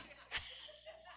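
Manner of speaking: squeaky laugh; high breathy laugh. Real, not acted. Preacher laughing at her own story.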